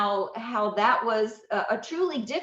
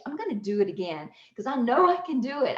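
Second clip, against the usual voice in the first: usual speaking voice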